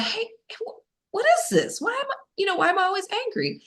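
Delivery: high, searching voice; questioning her anger. Retold or imitated. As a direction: call out to the anger inside, puzzled. Addressing her inner anger.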